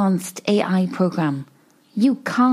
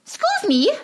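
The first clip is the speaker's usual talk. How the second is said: indignant; 11 semitones higher than usual. Acted. Sketch, indignant stranger.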